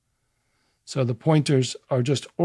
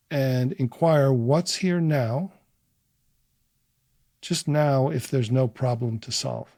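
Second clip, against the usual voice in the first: guided inquiry; 3 dB quieter than usual. Acted. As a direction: offer each phrase, then leave room to feel it. Leads a short guided inquiry.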